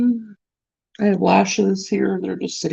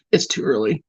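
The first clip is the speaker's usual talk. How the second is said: low mock voice; 9 semitones lower than usual.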